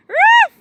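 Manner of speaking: one high, loud yappy bark; imitated bark. Shown as a demonstration. Barking again to coax the dog. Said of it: "Do it again".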